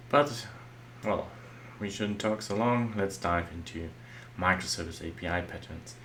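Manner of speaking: ordinary talk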